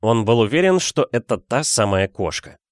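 ordinary delivery

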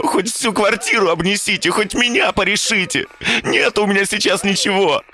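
high, wailing desperate cries; howling despair. Acted. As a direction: wail it out in despair. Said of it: "Парень увидел его и завыл". (отчаянный вой)

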